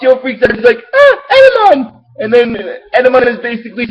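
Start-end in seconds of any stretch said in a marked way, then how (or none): 0.9-2.0 much higher mock panicked cry; hammed-up frightened shriek; Joe panicking. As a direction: yelp it like a scared kid. Joe freaks out.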